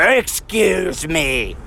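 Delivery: slow, drawn-out sarcastic "Excuse me"; mock the guy's snide politeness; stretched, snotty imitation of a stranger. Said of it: "very sarcastic when he said".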